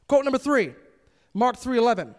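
ordinary delivery

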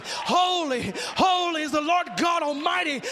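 usual speaking voice

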